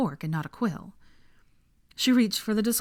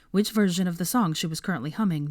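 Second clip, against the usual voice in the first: ordinary delivery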